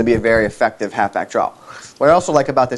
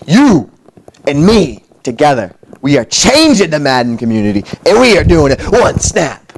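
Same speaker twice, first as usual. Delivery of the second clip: hyped sign-off; 4 semitones higher, 6 dB louder than usual. Acted. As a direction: sell the catchphrase big. Signature sign-off catchphrase.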